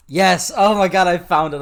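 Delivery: real excitement. Real, not acted. Host finds the funny video, excited outburst. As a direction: exclaim it loudly in triumph.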